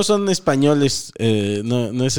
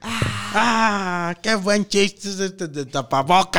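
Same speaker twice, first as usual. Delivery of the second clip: mock delighted reaction; 5 semitones higher, slower than usual. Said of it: "imitating people reacting to mask".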